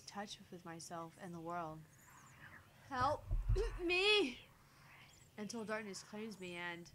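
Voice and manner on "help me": high, loud plea for rescue; desperate plea. Acted. Narrator losing touch with the world, loud desperate cry. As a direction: cry out, rising on the last word.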